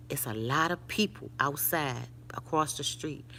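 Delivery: low, soft imitation of whispering; retold whispering. Daughter warning mom about people outside, retold whispering. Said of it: "My daughter is whispering".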